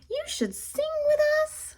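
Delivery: high sing-song bird voice; high birdlike invitation; birds' voice. A narrator's reading. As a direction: pitch up high like chirping birds.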